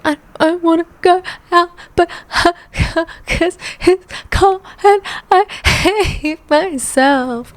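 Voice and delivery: stretched-out high whine; whiny high voice. Retold or imitated. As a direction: pitch it way up like a sulky kid. Mocking her own whiny self.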